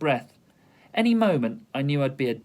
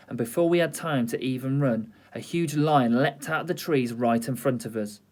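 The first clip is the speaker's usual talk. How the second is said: plain reading voice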